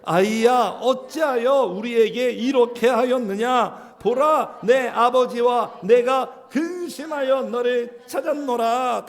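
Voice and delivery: slow, loud unison reading; loud slow recitation of the verse; scripture reading. Acted. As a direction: read the mother's rebuke out slowly together. Congregation reads verse aloud.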